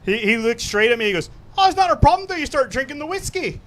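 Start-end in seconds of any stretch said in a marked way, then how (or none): 1.6-3.6 high-pitched mock Irish bartender; imitated Irish accent. Bartender reassuring customer behind his bar, imitated Irish accent. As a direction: deliver the punchline in a put-on Irish brogue. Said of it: "when the guy became Irish".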